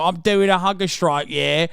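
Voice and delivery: mock British accent. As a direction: play a British mother in a put-on accent. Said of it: "like, a British person".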